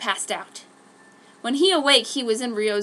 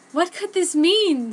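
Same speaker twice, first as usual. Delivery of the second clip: alarmed; 8 semitones higher, 6 dB louder, slower than usual. Acted. Alarmed at blood letter.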